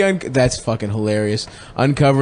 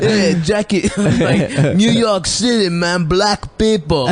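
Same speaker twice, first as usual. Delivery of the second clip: higher, laughing fake American from a Greek; slowly mock a Greek's American slang; cousin's American accent; 6 semitones higher, slower than usual. Cousin from Greece faking American to Jackie, mock accent. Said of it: "trying to do an American accent".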